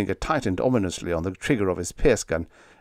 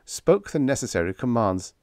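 ordinary delivery

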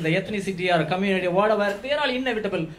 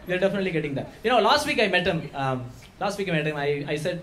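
ordinary talk

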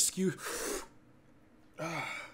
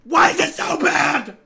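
anguished scream; 12 semitones higher, 16 dB louder than usual. Real, not acted. Screaming from the burn.

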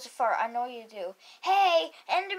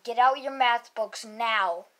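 ordinary delivery

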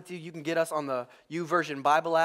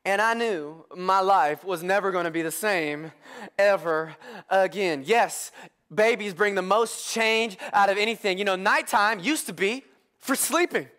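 usual speaking voice

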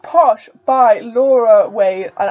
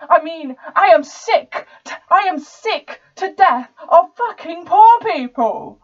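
slow, high, emphatic outburst; furious climax; 5 semitones higher, 4 dB louder than usual. Acted. Rich student sick to death of poor people, in a slow, furious outburst. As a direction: build to an exasperated, drawn-out peak.

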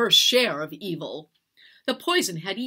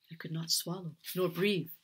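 plain reading voice

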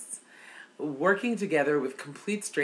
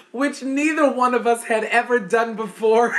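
higher and louder, laughing throughout; 7 semitones higher, 6 dB louder than usual. Real, not acted. Telling viewers neither had done it, laughing. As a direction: push the words out between laughs.

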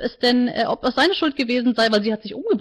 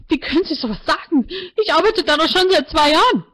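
higher, rising outrage; can't believe what she hears; indignant disbelief; 7 semitones higher, 4 dB louder than usual. A narrator's reading. Martina protests to the counselor, higher and indignant.

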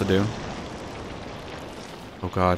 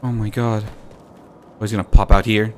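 usual speaking voice

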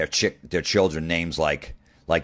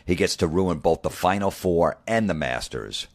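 ordinary delivery

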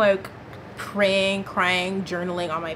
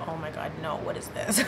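breathy near-whisper with a stifled laugh; soft, shocked murmur breaking into a laugh; stunned hushed disbelief; 5 semitones lower, 9 dB quieter than usual. Retold or imitated. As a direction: half-whisper it, caught off guard and amused. Song suddenly plays in her spirit.

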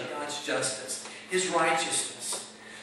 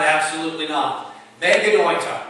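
loud, forceful outright rejection; emphatic denial; 7 dB louder than usual. Acted. Preacher voicing Paul's answer to his congregation, loudly emphatic. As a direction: slam it down with much more volume. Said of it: "It's emphatic".

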